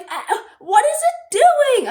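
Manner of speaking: put-on high, loud alarmed kid voice; the boy alarmed high and loud by the snake on his wrist; nervous boy. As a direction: ask it high and loud like a scared child. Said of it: "he's like".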